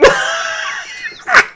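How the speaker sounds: very high squealing laugh; high shriek of laughter. Real, not acted. Laughing hard at chat.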